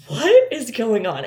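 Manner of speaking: loud, drawn-out disbelief; bewildered. Retold or imitated. Woman reliving her confusion at his sentimental spiel, bewildered. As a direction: stretch out a loud, baffled question.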